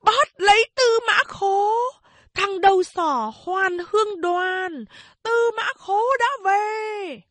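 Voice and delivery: hét cao vút, the thé; gào lên báo động cả thôn; kêu như chó sủa. A narrator's reading. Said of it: "tiếng kêu như chó sủa". (tiếng hét the thé như chó sủa)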